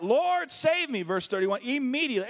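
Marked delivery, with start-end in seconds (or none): 0.0-1.0 desperate cry. Acted. Peter sinking cries out.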